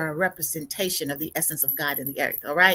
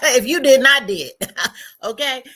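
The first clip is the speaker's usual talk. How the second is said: high, loud burst through laughter; gleeful, laughing outburst; 7 semitones higher, 9 dB louder than usual. Real, not acted. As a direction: laugh out the words, real amusement. Laughs after the breathing pause.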